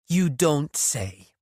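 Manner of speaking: slow, every word separately stressed; "You don't say" spelled out word by word, slow and pointed; enunciating every word. A narrator's reading. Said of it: "enunciating every word with an added glare".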